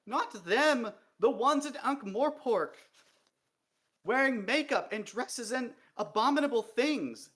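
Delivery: high, loud, furious rant near tears; venomous tirade, sobbing. A narrator's reading. Dee rants to the king about female dwarfs, venomous and sobbing. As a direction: spit every word with venom until it breaks. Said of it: "a word sprayed with so much venom".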